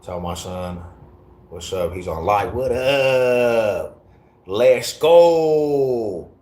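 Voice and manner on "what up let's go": hyped greeting. Real, not acted. Hype greeting to stream viewers.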